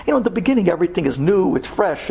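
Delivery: normal talking voice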